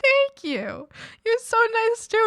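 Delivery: high gush. Retold or imitated. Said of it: "I'm like".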